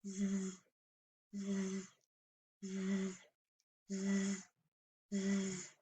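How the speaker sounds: "z" as a soft, long buzzing zzz; Z sound. Shown as a demonstration. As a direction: buzz each "z" quietly for kids to copy. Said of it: "Can you say it with me five times".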